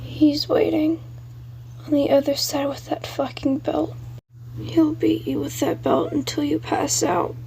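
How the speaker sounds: put-on a ghost child warning in a breathy hush, fading into whisper; haunted ghost boy. Billy's ghost voice.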